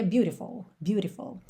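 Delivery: American "beautiful" with a flapped T, like "bure-tiful". Shown as a demonstration. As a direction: flap the T in "beautiful". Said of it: "No inglês americano". ("beautiful" americano, T batido)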